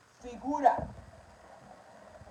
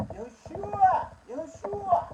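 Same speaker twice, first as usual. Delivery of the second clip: high, loud, drawn-out call; sing-out holler, pitched way up; mother calling her son; 13 semitones higher, 11 dB louder, much slower than usual. Acted. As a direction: call out "Yeshua" as a mother summoning her child. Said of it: "cuando le llamaba le decía".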